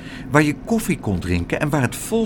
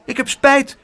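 high little voice, quickly called; quick child's apology; 11 semitones higher, 4 dB louder than usual. A narrator's reading. Biting child apologising after a bite, high and quick. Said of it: "riep hij snel".

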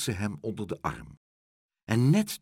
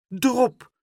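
high, loud cry of outrage; outraged cry; 14 semitones higher, 7 dB louder than usual. A narrator's reading. Mother finding liquorice on the pillow, outraged cry. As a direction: exclaim it sharply, high and loud. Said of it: "riep haar moeder".

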